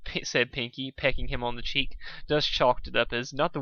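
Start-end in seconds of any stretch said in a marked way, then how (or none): none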